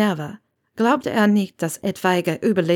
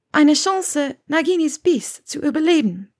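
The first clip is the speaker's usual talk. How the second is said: higher woman's voice, firm answer; Hermine; 9 semitones higher, 3 dB louder than usual. Acted. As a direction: answer high and insistent, lightly in character.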